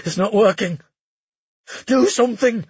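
desperate high shout. A narrator's reading. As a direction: yell it at her in panic. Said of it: "he shouted at her".

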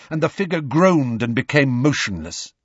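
ordinary delivery

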